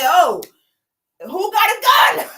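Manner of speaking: high, loud call for a gun; mock panicked shout. Narrator calling out in hammed-up panic at the scene. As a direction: yell it out like a panicked bystander.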